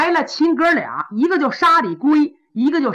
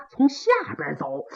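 usual speaking voice

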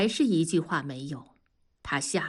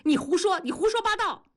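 incoherent with anger; 12 semitones higher, faster than usual. A narrator's reading. Husband lost for words at wife's accusation, enraged. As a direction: explode in shrieky indignant denial. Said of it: "气得语无伦次".

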